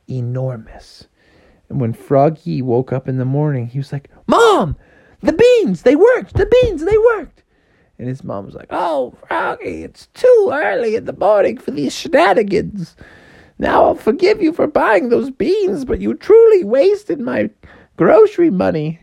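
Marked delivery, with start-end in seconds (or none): 4.3-7.4 put-on high, loud excited kid-frog; excited calling. Froggy waking mom about the magic beans, excited. 8.7-19.0 high falsetto mother frog; put-upon mom in a piping voice; scolding mom. Acted. Mom woken too early scolds her son, complaining.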